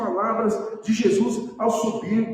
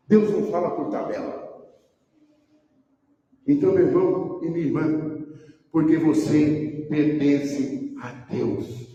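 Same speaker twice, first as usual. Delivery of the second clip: ordinary talk, nothing marked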